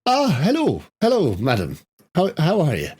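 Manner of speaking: high, fussy shopkeeper voice; shopkeeper greeting. Acted. Shopkeeper Brian greeting his wife, high and fussy. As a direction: fuss over the lady politely. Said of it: "Brian, just".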